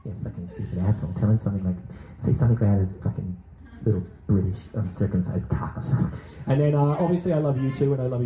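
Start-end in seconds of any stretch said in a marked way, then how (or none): 0.0-1.8 low, fast sneaky murmur; worm tongue whisper. Pretend. Worm tongue egging on a heckler, low and quick. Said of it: "You're like the worm tongue". 2.2-6.2 low, fast sneaky murmur; worm tongue whisper. Pretend. Worm tongue feeding insults to the crowd, low and fast. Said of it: "You're like the worm tongue".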